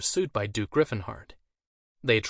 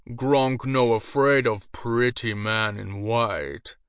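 slow, louder brute voice; ogre taunt; 4 dB louder, much slower than usual. A narrator's reading. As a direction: dumb ogre sneering at the mage.

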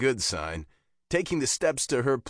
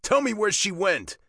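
angry demand; 10 semitones higher, 4 dB louder than usual. A narrator's reading. Biker demands answers from father.

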